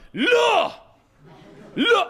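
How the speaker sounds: high-pitched shouted bark of one word; short high mock shout; imitated angry yelling. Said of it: "He starts yelling".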